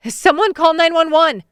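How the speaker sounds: high, loud urgent yell. Acted. Narrator yelling at the staring kids to call for help, loud alarm. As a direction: scream for help across the court. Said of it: "I yell".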